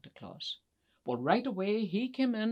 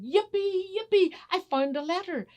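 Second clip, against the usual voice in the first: very high, loud squeal of joy; jubilant elf; 13 semitones higher, 7 dB louder than usual. Acted. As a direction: burst out with the great find. Elf finds the wished-for letter.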